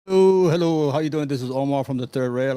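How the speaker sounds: ordinary talk, nothing marked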